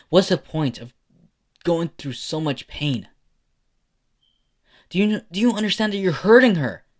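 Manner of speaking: angry confrontation. Acted. Someone confronting a father for hurting his own daughter, angry and building.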